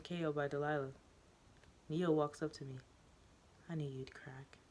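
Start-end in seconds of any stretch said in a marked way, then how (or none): 3.7-4.6 soft, hushed murmur; whisper. Acted. The student whispering to the teacher. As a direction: lean in and breathe it. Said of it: "she whispers".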